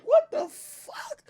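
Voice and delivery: very high, drawn-out squeal of disbelief; ranting voice shooting up into a squeak; exasperated rant. Real, not acted. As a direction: stretch it out way up high, incredulous. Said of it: "Let me stop ranting over this shit".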